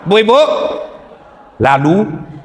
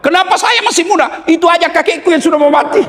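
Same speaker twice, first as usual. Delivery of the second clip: put-on high, fast, far pitched-up teen voice; whiny modern kid. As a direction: act a cheeky kid talking back. Voicing a modern kid's retort. (anak bandel merengek dengan suara tinggi)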